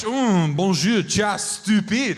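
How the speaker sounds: loud, mock-seductive French; hammed-up French sweet talk; French accent. Comedian showing French fails with insults, mock French accent. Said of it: "Even in French".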